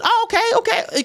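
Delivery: high-pitched, over-polite agreement; placating. Retold or imitated. Acts own polite retold reaction.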